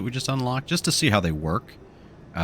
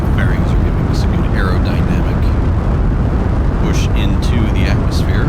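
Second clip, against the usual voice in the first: loud raised voice over engine roar; shouting over engine; 2 semitones higher, 7 dB louder than usual. Real, not acted. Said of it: "I know I'm yelling over the, uh, the engine".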